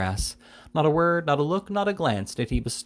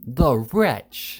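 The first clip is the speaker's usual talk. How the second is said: loud, higher, drawn-out outrage; scandalized exclamation; indignant; 3 semitones higher, 5 dB louder than usual. A narrator's reading. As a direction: spit it out with energetic indignation. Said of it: "exclaimed the spinster with energetic indignation".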